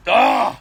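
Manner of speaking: loud exasperated ugh; frustrated groan. Retold or imitated. Frustrated groan while telling wife he quits. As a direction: groan out the rage. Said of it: "out of rage and frustration".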